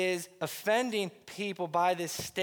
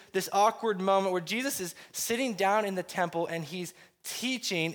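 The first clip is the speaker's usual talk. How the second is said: ordinary talk, nothing marked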